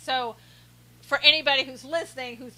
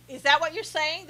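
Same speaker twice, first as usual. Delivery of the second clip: ordinary delivery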